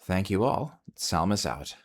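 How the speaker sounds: normal talking voice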